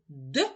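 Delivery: «д» as a short hard d, not palatalized; hard consonant sound. Shown as a demonstration. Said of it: "Твердий приголосний звук".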